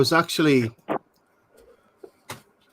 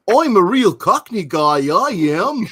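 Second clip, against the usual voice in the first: mock Cockney; 5 semitones higher, slower than usual. Mocking Hollywood's fake Cockney for friends. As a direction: send up a fake American Cockney.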